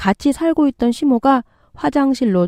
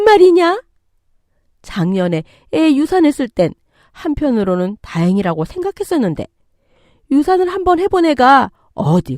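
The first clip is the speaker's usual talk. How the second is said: indignant scolding, higher pitch on the jabs; angry mother-in-law; 5 semitones higher, 4 dB louder than usual. Acted. Mother-in-law attacking daughter-in-law over her pregnancy, angry. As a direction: berate her with rising, accusing questions. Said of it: "왜 이렇게 화가 나셨어요".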